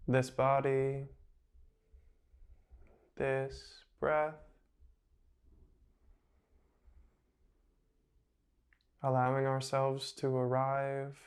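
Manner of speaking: slow, soft meditation voice with long pauses between phrases; guided meditation. Acted. Meditation guide settles listeners lying down into the moment, slow and soft. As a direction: ease them gently into the breath, unhurried.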